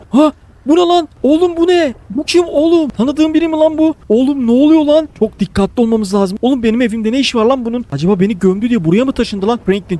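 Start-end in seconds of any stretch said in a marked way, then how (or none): 0.1-5.1 high, loud, alarmed yelps; real spooked cries, pitched high and loud; startled alarm. Real, not acted. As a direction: jump out of your skin at a stranger. Spooked by a figure in his house.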